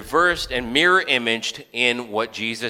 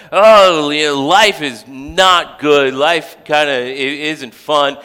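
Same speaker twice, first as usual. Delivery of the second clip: high, whiny grumble, slowed down at first; mock complaint of grumbling people; whiny complaining Israelites; 4 semitones higher, 9 dB louder than usual. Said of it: "they're like".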